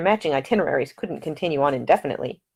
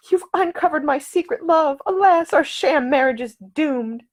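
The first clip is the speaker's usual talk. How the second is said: high, slow melodramatic heroine; mock swoon; 11 semitones higher, 3 dB louder than usual. A narrator's reading. Ida teasing Nick, hammed-up melodramatic lament. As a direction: ham up a tragic damsel. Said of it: "in a mock swoon".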